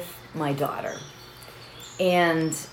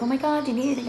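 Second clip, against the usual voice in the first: lightly imitated concerned store helper, higher pitch; 6 semitones higher, much faster than usual. Store helper to customer having a hot flash, concerned. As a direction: quote a worried offer of help, pitched up.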